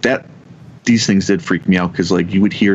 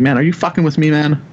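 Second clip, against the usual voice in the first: higher-pitched, irritated challenge; mildly exasperated retold accusation; retold annoyance; 7 semitones higher than usual. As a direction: act out being fed up with a prankster. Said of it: "I was pissed off that day".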